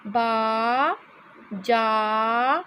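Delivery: बाजार stretched slowly, the आ held long; slow sounding out. Shown as a demonstration. Teacher sounding out the आ sound slowly. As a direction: draw out बाजार for the class. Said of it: "देखिए यहाँ पर जो sound है वो आ की है".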